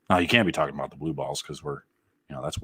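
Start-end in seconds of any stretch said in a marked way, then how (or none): none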